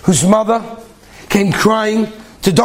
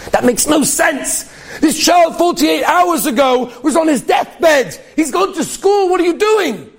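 put-on can't believe the dying child is at school; stunned disbelief; 10 semitones higher, slower than usual. Doctor to the mother about her son, astonished near-shout.

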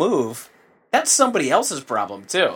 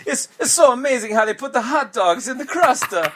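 put-on high, hammy Italian accent; jolly Italian pizza chef; 11 semitones higher than usual.

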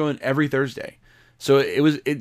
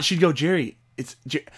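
normal talking voice

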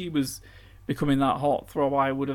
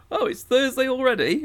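surprised; 10 semitones higher, 6 dB louder than usual. Pretend. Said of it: "and it'll be like".

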